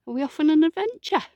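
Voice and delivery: high, quick sing-song to a dog; chirpy voice for the dog; pet talk. Real, not acted. Talking to the dog.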